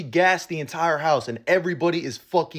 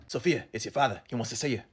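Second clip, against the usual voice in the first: quiet messenger voice; 5 semitones lower, 7 dB quieter than usual. Retold or imitated. Messenger summoning Sophia at party.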